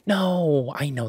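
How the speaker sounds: loud, high drawn-out "no"; playful protest. Acted. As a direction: protest loudly through amusement. Playful protest after joking.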